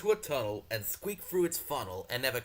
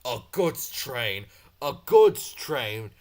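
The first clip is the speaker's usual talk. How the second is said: slow, loud grumbling, rising louder each time; 8 dB louder, much slower than usual. Acted. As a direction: grumble with building disgust. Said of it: "he grumbled".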